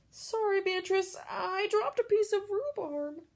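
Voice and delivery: put-on high, muffled little-girl voice; friend apologizing. A narrator's reading. Friend with an egg in her mouth apologizing to Beatrice. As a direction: say it like a kid with her mouth full.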